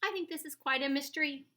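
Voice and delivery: high, loud, fast chirp; chirpy. A narrator's reading. Flap chirps his thought to the friends, chirpy. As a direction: chirp it brightly and quick. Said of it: "Flap chirped".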